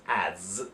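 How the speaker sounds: "as" ending in a voiced, buzzed Z; Z sound ending. Shown as a demonstration. Teacher reading out the tricky word, "as" with buzzed Z. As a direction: say "as" with a drawn-out Z at the end. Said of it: "a Z sound".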